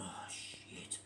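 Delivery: soft whispered dismay; whispered realisation; whispered oh shit. Retold or imitated. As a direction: breathe it out in alarm. Realising they've entered wrong bar.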